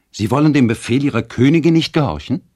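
laut und hoch, wie eine launische Königin befehlend; gebieterisch. A narrator's reading. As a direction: herrisch fordern, halb im Spiel. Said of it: "rief Luciane gebieterisch". (gespielt empört und gebieterisch)

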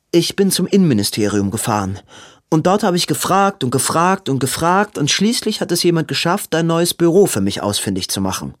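fast, high, breathless sister; hasty Maud. Acted. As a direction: rush through it before he asks. Maud hurries her explanation.

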